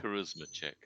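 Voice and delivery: ordinary talk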